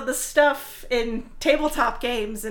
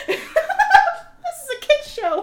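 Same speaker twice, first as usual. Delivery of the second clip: laughing scold; 8 dB louder, much slower than usual. Real, not acted. Scolding a friend for swearing.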